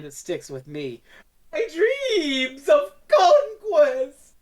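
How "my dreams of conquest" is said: high, slow, crying villain wail; hammy villain wail. Retold or imitated. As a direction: wail it like a ruined tyrant. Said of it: "The way he says it".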